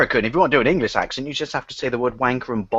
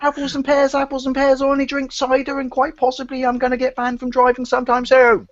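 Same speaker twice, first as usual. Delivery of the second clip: high, held-up Cockney caricature; mock Cockney; 11 semitones higher than usual. Joker on cider and driving bans, mock Cockney. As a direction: pitch a comic Cockney way up. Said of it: "And I'm Cockney".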